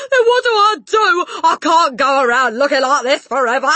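high, very loud despair; panicked shout. A narrator's reading. Father to wife, panicked shouting. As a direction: cry out desperately.

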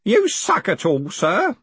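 high-pitched offer, lightly strained; a hint of teary-eyed old underling; old man recovering from coughing. A narrator's reading. Grote offers the tin after coughing, high old-man voice. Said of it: "tears rolling down his cheeks".

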